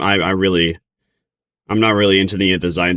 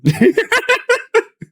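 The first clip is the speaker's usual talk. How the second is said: high-pitched tittering laugh; high giggle. Real, not acted. Streamer amused by own Nanai joke, high giggle. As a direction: let out a squeaky giggle.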